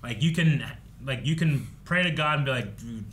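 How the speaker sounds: normal talking voice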